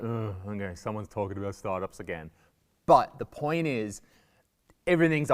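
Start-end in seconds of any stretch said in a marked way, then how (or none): 0.0-2.3 low, softer, fed-up mumble; weary groan. Acted. Listener sick of hearing about startups, weary. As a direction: let it sag with mock weariness.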